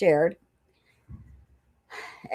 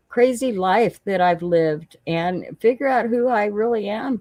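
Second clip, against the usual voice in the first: ordinary talk, nothing marked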